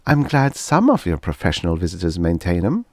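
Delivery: usual speaking voice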